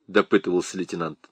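ordinary delivery, nothing marked

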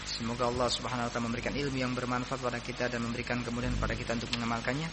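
low, soft, quick recited prayer; closing prayer. Real, not acted. Closing prayer ends the lesson. (doa penutup yang lirih dan cepat)

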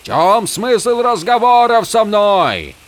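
high, loud, slow outcry; anguished yell upward; shouting at the sky. A narrator's reading. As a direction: cry it out to the heavens. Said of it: "прокричал Холлин, обращаясь к небу".

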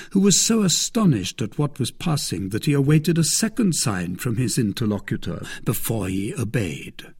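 usual speaking voice

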